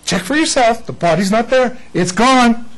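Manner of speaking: a touch of a herald; angel's announcement. Retold or imitated. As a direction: proclaim it to the women. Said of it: "or the angel said".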